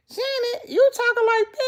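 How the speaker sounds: high, loud laughing shriek; shocked laugh. Real, not acted. Shocked laughing reaction to comment.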